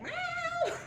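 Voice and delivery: high, far meow imitating the cat. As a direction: mew like the cat at the door. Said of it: "and then he's like".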